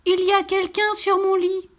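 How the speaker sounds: put-on high, surprised baby bear cry; baby bear exclaiming in surprise; astonished little bear. A narrator's reading. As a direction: gasp it out like a startled cub. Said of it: "s'étonna".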